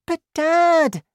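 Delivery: put-on very high, loud child's voice; young son interrupting. A narrator's reading. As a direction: cut in on Dad as a small boy. Boy tries to interrupt his father.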